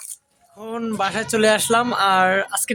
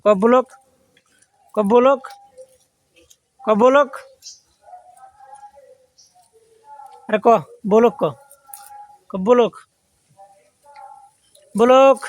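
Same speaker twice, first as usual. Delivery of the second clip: loud coaching call; 3 semitones higher, 4 dB louder than usual. Real, not acted. Vlogger loudly drilling his goat to say blog, loud call. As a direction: coax a goat to say the word, loud.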